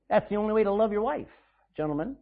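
normal talking voice

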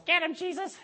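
high-pitched parrot giving an order; high bird-voice attack call; parrot command. Acted. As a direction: sic the dog on him in a parrot voice. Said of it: "that parrot goes".

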